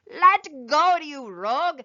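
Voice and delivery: high, loud yell down the hole; angry yell. A narrator's reading. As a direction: shout at the rabbit to let go. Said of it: "the little man yelled down the hole".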